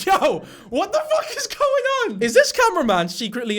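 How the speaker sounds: very high-pitched, incredulous exclamation; shocked outburst. Real, not acted. Man reacting to cameraman's rude remark, high-pitched disbelief. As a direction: burst out in disbelief, voice shooting up.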